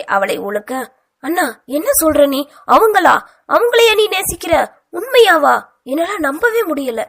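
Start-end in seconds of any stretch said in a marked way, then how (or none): 1.2-5.6 much higher and louder, shocked cry; stunned disbelief. Acted. Sister hears who her brother loves, shocked high cry. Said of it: "இப்போதும் அதிர்ச்சி மாறாமல்". 5.8-7.0 higher, still reeling from shock; shocked disbelief. Acted. Sister reeling at the news of her brother's love, shocked disbelief. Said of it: "அதிர்ச்சி மாறாமல்".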